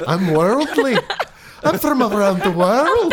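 high mock little-boy voice; worldly little boy. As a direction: imitate a boy with a nondescript accent. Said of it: "imagine a little boy telling you".

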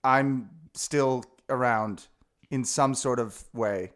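slow, careful dictating to phone; slow dictation. Acted. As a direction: spell it out for the phone. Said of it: "send that text right now".

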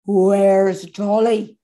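low, very slow demand; ominous parental questioning; stern mother. Acted. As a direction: draw it out with menace. Mother confronts the boys.

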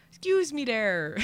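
rough imitation of an Irishman's accent; mock Irish accent. Irish cyclist stops her on the street, mock Irish accent. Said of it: "or what, however he talked".

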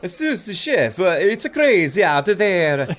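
high, loud hammy Italian waiter; Italian accent. Acted. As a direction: play it big Italian. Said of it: "both boys speak in thick Italian accents".

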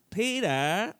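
high, rising Family Guy attempt. Shown as a demonstration. Guest demonstrating his best accent, Family Guy voice. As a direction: try a shaky grasp at a Family Guy voice. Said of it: "a Family Guy accent".

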